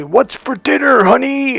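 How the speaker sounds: high, slow, drawn-out call; cheery calling out. Retold or imitated. Husband walking in asks wife about dinner, cheery call. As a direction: imitate a husband hollering into the kitchen.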